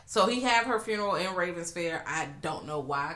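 ordinary delivery

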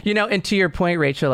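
ordinary delivery

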